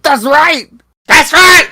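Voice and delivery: high, loud, fired-up yell; hyped agreement. Retold or imitated. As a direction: shout it like a fan. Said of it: "he's like".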